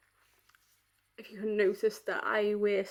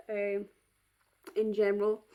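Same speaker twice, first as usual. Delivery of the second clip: ordinary talk, nothing marked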